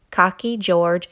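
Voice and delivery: plain reading voice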